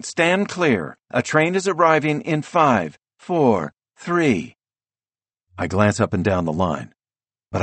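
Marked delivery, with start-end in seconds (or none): 0.0-4.6 high, urgent station countdown; urgent announcer voice. A narrator's reading. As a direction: blare the countdown like a platform speaker. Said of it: "An urgent female voice blares through a speaker overhead".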